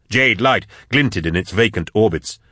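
ordinary delivery

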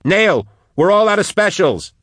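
high-pitched cry to a comrade; pitched high, calling out in alarm; urgent cry. A narrator's reading. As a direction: cry out the bad news. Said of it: "she cried".

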